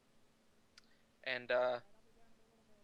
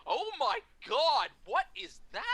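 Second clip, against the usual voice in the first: retold scandalized outcry, pitched way up; mock shock; 13 semitones higher, 6 dB louder than usual. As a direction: draw out a hammed-up aghast reaction. Colleague's shocked reaction retold.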